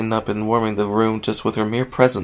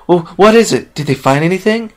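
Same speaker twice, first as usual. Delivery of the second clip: eager, higher, louder questions; hopeful; 7 semitones higher, 5 dB louder than usual. Acted. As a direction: ask with rising hope. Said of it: "Rainbow Dash asked hopefully".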